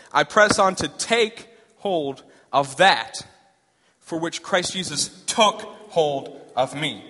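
each word pressed out slowly; slow emphatic reading. Shown as a demonstration. Preacher to congregation, slow and weighty verse reading. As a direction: read the verse slow and weighty so they hear it. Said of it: "but listen hard".

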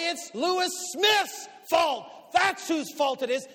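loud, high, slow furious lecture; rising rant that peaks in a shout; angry tirade. Retold or imitated. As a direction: lay into her, building to a shout. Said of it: "I'm gonna say".

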